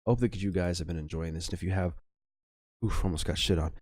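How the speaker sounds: ordinary delivery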